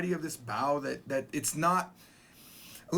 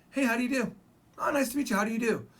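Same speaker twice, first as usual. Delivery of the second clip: higher-pitched mock small talk; acted casual greeting; 6 semitones higher, faster than usual. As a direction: play both sides of a quick hello. Acting out an English greeting exchange.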